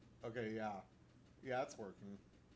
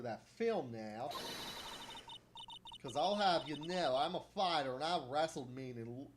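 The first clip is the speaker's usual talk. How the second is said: louder, Southern-drawled scolding; irritated Southern shopkeeper voice; Southern camera lady, annoyed; 3 semitones higher, 7 dB louder, slower than usual. Acted. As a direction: tell off careless tourists in a drawl.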